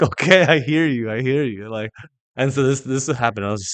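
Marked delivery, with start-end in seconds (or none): 0.0-1.7 loud, higher-pitched concession to God; raised-voice, acted-out giving in; retold emphatic surrender. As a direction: give in loudly, as if overwhelmed, retelling it.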